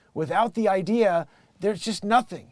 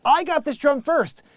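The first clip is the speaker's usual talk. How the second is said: raised-voice claim of being first; mock insistent boast; 6 semitones higher, 5 dB louder than usual. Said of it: "and I'm like".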